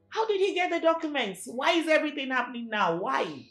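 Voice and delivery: loud, rising panic; distraught woman venting to her friend; panicked outburst. Acted. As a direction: spill the questions out in panic. Panicking over the theft.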